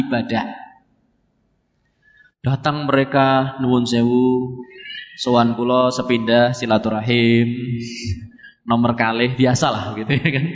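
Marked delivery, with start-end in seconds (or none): 3.6-9.4 low, polite Javanese greeting; acted courteous guest; deferential visitor. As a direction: ask humbly in a lowered voice. Brothers politely visit the monk.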